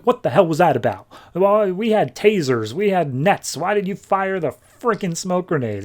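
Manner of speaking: a hammed-up boss yelling at his officer; chewing out. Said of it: "chews out Bard".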